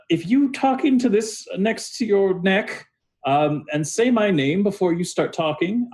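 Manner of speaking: high-pitched, halting character voice; queasy David giving instructions. Acted. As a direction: explain it carefully in a high, put-on voice.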